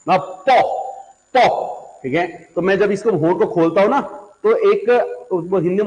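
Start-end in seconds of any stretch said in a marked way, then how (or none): 0.1-2.0 "पो" spat out as a small explosion; popping po. Shown as a demonstration. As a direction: burst out a sharp "पो" like a firecracker. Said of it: "एक पटाखा के तौर पे".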